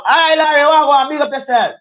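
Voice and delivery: high, loud hello breaking into the talk; shouted greeting. Real, not acted. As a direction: hail a newcomer at full voice. Breaks off to greet arriving viewer.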